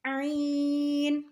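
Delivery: عین pressed in the throat and drawn out; mid-throat sound. Shown as a demonstration. Teacher showing where pressure is felt, عین from the throat. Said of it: "اسی جگہ سے ادا ہوگا".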